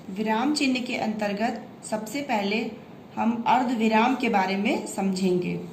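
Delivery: usual speaking voice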